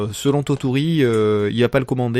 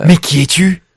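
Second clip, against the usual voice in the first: booming voice; 4 semitones higher, 9 dB louder than usual. Acted. Mock dramatic intro call.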